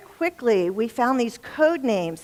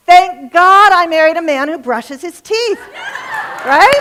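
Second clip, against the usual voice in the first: loud, high, triumphant punchline; big comic reveal; 8 semitones higher, 11 dB louder than usual. Real, not acted. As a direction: proclaim it to the room like a revelation. Punchline to audience.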